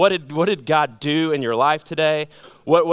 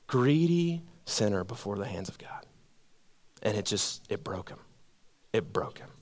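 hushed whisper; 8 semitones lower, 11 dB quieter than usual. Real, not acted. Preacher hushing the congregation at the story's end, whispered. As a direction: drop to a near whisper, broken.

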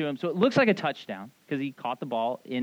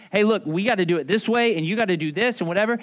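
louder, higher, insistent retold argument; mock arguing; 3 semitones higher, 6 dB louder than usual. Martha comes out busy to argue with Jesus, insistent. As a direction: push it like a bossy imitation. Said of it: "basically argues with Jesus".

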